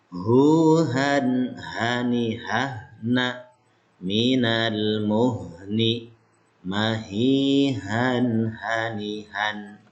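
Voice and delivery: Minal muhni drawn out very slowly, ha pronounced from the throat; slow Arabic recitation. Shown as a demonstration. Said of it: "Nah, terakhir". (bacaan lambat dengan ha dari tenggorokan)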